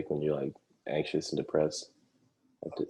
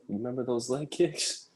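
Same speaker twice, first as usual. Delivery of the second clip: high, drawn-out imitation of yelling; mock yell; 8 semitones higher, slower than usual. Player taunting his opponent over the game, mock yell. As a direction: stretch it out high, without real volume. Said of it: "he's just yelling".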